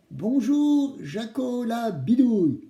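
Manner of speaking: put-on slow high prompt for the children to repeat; kids show host. Shown as a demonstration. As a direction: model the reply for the kids. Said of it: "vous devez me répondre". (animateur pour enfants qui montre la réponse)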